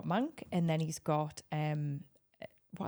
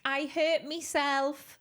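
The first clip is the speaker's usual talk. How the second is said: put-on toddler Geordie; 6 semitones higher, much slower than usual. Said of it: "he was like".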